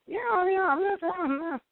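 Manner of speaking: very high, squeaky run of yeahs; mocking the English dub shaman, very high. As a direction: parody the dub actor's voice, way up high. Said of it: "his voice kinda just sounded like".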